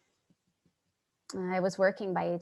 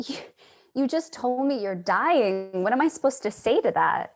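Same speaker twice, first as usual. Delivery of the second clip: louder, fast, upset disbelief; shocked outburst; 5 semitones higher, 8 dB louder than usual. Acted. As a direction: blurt it out in shock. Charlie shocked by Joe's news.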